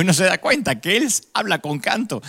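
retold retort said through a laugh; chuckling while quoting himself; laughing reply. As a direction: riendo al contar su respuesta. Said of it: "Y le digo".

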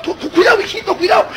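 high, stuttering mock warning; anxious father. Father chasing his toddler to the other side, mock-anxious stammer.

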